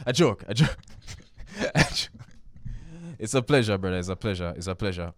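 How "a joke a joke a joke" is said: high laughing take-back; giggly "just kidding". Real, not acted. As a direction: chuckle and retract it. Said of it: "Clear it's been a very slow day".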